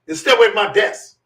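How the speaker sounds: loud, high mock-sergeant command; stern order. Sergeant orders him off, retold.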